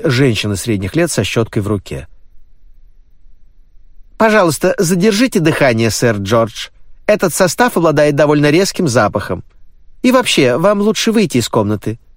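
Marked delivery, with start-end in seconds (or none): 4.2-12.0 high, brisk middle-aged woman; brisk nanny. A narrator's reading. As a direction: fuss over him like a bossy nanny. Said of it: "сказала она".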